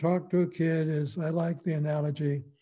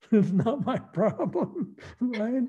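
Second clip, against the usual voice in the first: laughing; 6 semitones higher, slower than usual. Real, not acted. Laughing at own joke.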